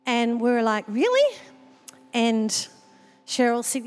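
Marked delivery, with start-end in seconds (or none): 0.9-1.4 high surprised "Really?"; a high, disbelieving really. Retold or imitated. Surprised reply to request.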